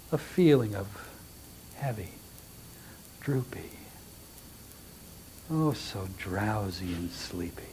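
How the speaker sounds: very soft, low, slow and drowsy; hushed hypnotist's murmur, sinking into a whisper; hypnotic induction. Shown as a demonstration. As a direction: lull them to sleep with long pauses between words. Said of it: "you would talk like that".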